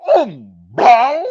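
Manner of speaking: a loud, high, drawn-out fake fart sound; mock fart noise. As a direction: blurt it out like a sudden fart. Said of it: "all of a sudden I go".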